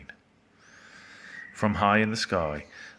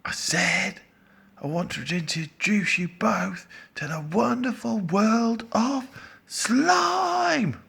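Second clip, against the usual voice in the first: high, loud announcement building to a yell; shouting louder; 5 dB louder, slower than usual. A narrator's reading. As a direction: call it out, stretching the last word. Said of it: "Shout louder".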